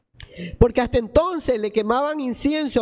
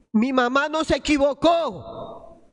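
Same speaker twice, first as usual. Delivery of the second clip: ordinary talk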